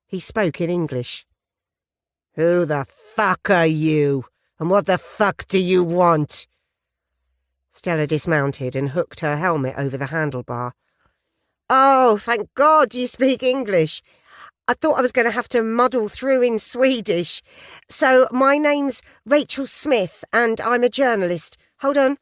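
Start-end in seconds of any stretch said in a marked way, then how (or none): 2.3-6.5 slow, louder, menacing biker voice; a hard biker leader, slow and loud; hostile biker. Acted. Armed biker confronts stranger. 11.7-22.2 much higher, louder, gushing; higher and louder, breathless with cheer; over-enthusiastic writer. Acted. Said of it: "An over-enthusiastic writer".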